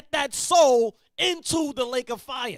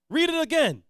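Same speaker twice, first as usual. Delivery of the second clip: ordinary talk, nothing marked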